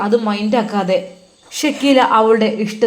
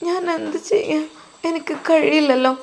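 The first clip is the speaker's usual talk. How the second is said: put-on helpless little girl; 6 semitones higher than usual. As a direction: plead like a small helpless girl. Crippled girl pleads with stepmother. (നിസ്സഹായയായ കൊച്ചുകുട്ടിയുടെ ഉയർന്ന നേർത്ത ശബ്ദം)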